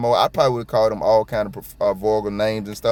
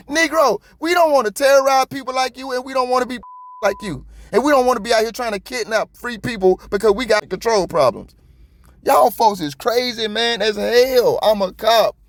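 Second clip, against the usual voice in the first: high-pitched, outraged rant; real indignant outburst, pitch soaring; heated rant; 12 semitones higher, 3 dB louder than usual. Real, not acted. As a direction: vent it at full heat, voice way up. Continues outraged rant at cops.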